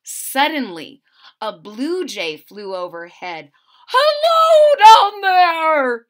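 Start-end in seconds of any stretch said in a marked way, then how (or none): 3.9-6.1 high loud call from the sky. Acted. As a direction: call down to the leaves from way above. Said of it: "he called out".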